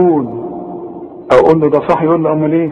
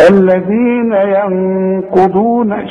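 ghunna recitation; 4 semitones higher, much slower than usual. Shown as a demonstration. Teacher chants the verse showing ghunna. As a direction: chant الذين ينقضون very slowly, humming the نون for two beats. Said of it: "الغنة لا تزيد ولا تنقص".

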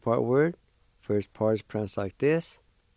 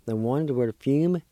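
normal talking voice

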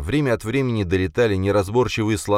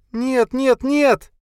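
high, louder and louder wails; rising anguished cry; 5 dB louder, much slower than usual. A narrator's reading. Linda wailing over dead Blakely, anguished. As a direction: cry out in mounting grief.

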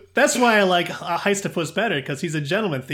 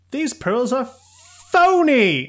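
rising higher and louder up to "phony"; 9 semitones higher, 7 dB louder, slower than usual. Retold or imitated.

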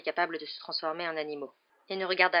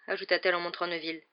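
usual speaking voice

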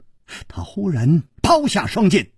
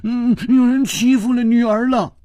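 high, loud sobbing complaint; whimper, then wail for daddy; tearful whine; 9 semitones higher, 3 dB louder than usual. Acted. Daughter complaining to her father, crying voice. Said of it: "带着哭音说".

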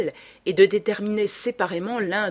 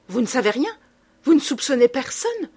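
urgent challenge; 5 semitones higher, 4 dB louder than usual. A narrator's reading. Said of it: "apostrophant le baron".

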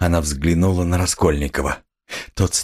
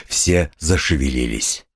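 usual speaking voice

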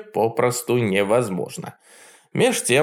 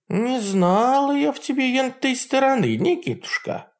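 put-on high old witch, cold and drawn out; cold drawl; 13 semitones higher than usual. A narrator's reading. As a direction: drag it out icily, old hag. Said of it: "холодно протянула Баба Яга".

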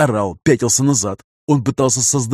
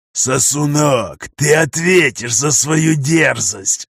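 slow, menacing monstrous voice; inhuman growl; 3 semitones higher, much slower than usual. Acted. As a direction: eldritch horror snarl. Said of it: "прорычал Кобальт нечеловеческим голосом".

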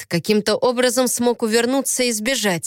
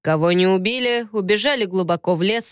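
ordinary delivery, nothing marked

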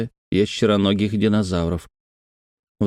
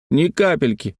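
put-on raised-pitch child's denial; defiant boy; 6 semitones higher than usual. A narrator's reading. Brother denies being scared to his sister, high defiant voice. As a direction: deny it as a needled little boy. Детская перепалка, брат возражает.

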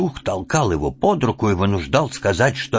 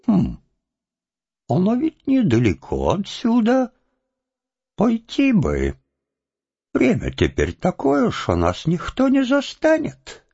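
higher, sly musing; sly scheming; 12 semitones higher than usual. Acted. Chub plans a visit to Solokha, sly and higher.

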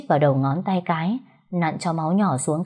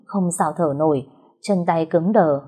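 usual speaking voice

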